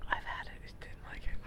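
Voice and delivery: hushed whisper. Real, not acted. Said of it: "Why are we whispering?".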